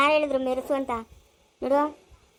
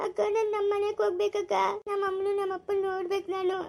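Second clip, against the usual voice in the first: high, softer tearful plea; tearful pleading; 4 semitones higher, 4 dB quieter than usual. Acted. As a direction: whimper a homesick plea. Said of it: "ಇದ್ಯಾಕ್ ಇವ್ಳು ಅಳ್ತಾವಳೆ". (ಅಳುತ್ತಾ ಮನೆಗೆ ಹೋಗಬೇಕೆನ್ನುವುದು)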